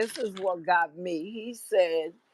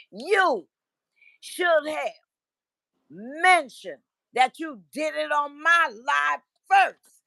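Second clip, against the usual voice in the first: high, loud, slow indignant lecture; mock his angry scolding; imitating an angry man; 6 semitones higher, 5 dB louder than usual. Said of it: "He said".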